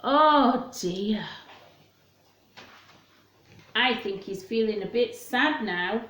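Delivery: ordinary delivery, nothing marked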